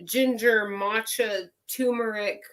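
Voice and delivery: usual speaking voice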